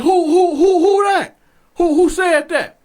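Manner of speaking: loud, high demand of who spoke; mock yell. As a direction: bark it out like a guard.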